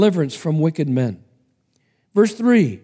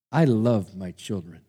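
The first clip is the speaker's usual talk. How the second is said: soft, lower tender words; 6 semitones lower, 4 dB quieter than usual. Acted. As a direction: speak love over his children. Father speaks tenderly.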